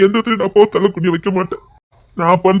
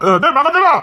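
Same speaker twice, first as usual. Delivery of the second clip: high, raised call of a name; 7 semitones higher than usual. Acted. Father calls out to Mahadevan across the yard, raised call. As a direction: call him over from a distance. Calling a man from afar.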